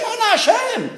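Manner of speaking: very high-pitched imitated congregational cry; excited prayer cry. Teacher contrasting verses of Hallel, excited cry. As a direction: pitch it way up like an eager worshipper. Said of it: "lack of excitement".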